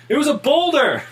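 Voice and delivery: high shouted cry; yelling. Acted. NPC yells out after message.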